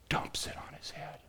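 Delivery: breathless whispered storytelling. A narrator's reading. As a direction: whisper it, hushed and intimate. Hushed climax of the story.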